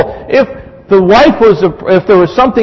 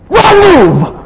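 much higher punched "wrong move"; emphatic warning; 12 semitones higher, 4 dB louder than usual. Real, not acted. Pastor warns husbands in congregation, sharp high exclamation. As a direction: call it out like a referee.

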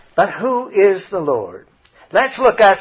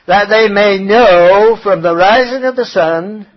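loud, raised proclamation, slowing at the end; emphatic scripture reading; 3 semitones higher, 8 dB louder than usual. Acted. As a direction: ring the verse out to the congregation.

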